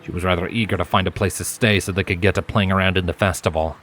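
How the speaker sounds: usual speaking voice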